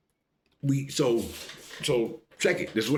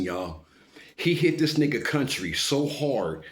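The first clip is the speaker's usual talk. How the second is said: ordinary talk, nothing marked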